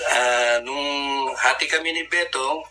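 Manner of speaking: ordinary talk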